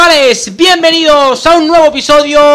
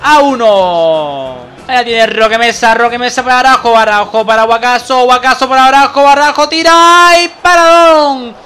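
brisk football commentator voice; live play-by-play; 3 semitones lower than usual. Acted. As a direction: call the match as it happens. (narración de partido en directo)

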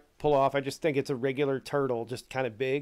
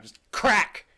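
mimicked crack; 6 semitones higher, 6 dB louder than usual. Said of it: "really loud".

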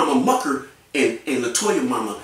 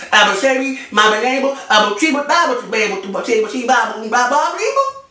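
higher, louder mush-mouthed parody, climbing on the last Bible; mocking impression; 8 semitones higher, 5 dB louder than usual. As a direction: ham up his garbled way of talking. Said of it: "That's you".

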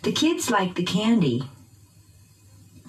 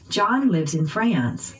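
slow, "John" and "France" stressed; unhurried drill, peaks on "John" and "France"; noun stress. Shown as a demonstration. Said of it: "using pitch change".

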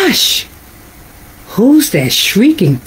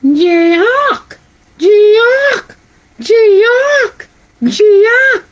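monkey cry; 9 semitones higher, much slower than usual. Acted. Reader voicing the monkey's cry. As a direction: screech it like a monkey. Monkey cries in lullaby story.

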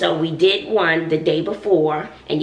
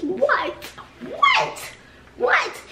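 higher with each What, playful; mock disbelief; much slower than usual. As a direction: tease it like you can't believe it. Repeated comic disbelief.

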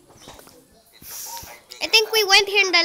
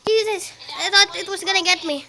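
ordinary delivery

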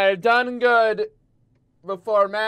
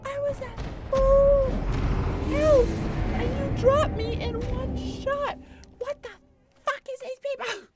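outraged high voice. Real, not acted. Outraged after a one-shot death.

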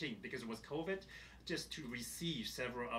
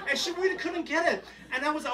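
usual speaking voice

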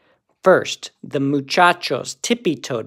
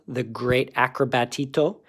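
usual speaking voice

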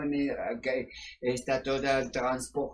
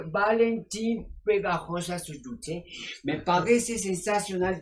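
ordinary delivery

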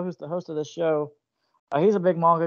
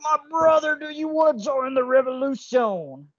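high, slow gravelly wrestler promo voice; over-the-top wrestling hype voice; Macho Man impression; 8 semitones higher than usual. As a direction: ham it up like Macho Man Randy Savage. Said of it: "gonna do a bad Macho Man impression".

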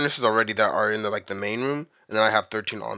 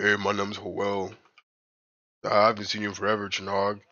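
low, softer deep-voiced greeting; mock deep voice; 5 semitones lower, 5 dB quieter than usual. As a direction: put on a deep voice to introduce yourself. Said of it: "if your voice got deep like that".

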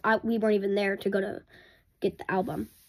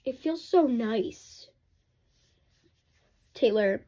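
usual speaking voice

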